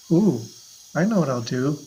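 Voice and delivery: normal talking voice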